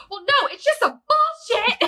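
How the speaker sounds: very high, tearful mock outburst; mock angry crying. Retelling her phone call when overwhelmed, mock angry crying. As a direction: squeal it like crying while mad.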